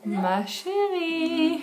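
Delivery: high, slow, drawn-out ghostly call; eerie voice. Retold or imitated. Hearing a ghostly voice at night, eerie slow call. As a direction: stretch it out like a phantom calling in the night. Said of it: "Tu recommences à dormir".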